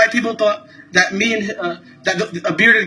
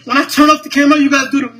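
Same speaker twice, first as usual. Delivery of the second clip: angry defiance; 5 semitones higher than usual. Real, not acted. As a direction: dare them to make him stop filming. Refuses to stop filming.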